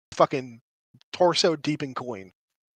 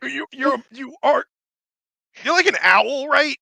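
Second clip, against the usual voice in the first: higher, louder mocking rant; ranting character; 4 semitones higher, 7 dB louder than usual. The voice mocks the owl player, loud ranting. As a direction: needle the bird person. NPC rants at bird person.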